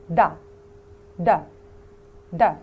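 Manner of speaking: دا as a short crisp d, repeated; teacher's d sound drill; d sound demo. Shown as a demonstration. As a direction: tap out دا as a clean English d. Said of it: "لكن نطقه".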